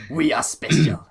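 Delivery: loud, high declaration; mock proud. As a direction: announce it proudly.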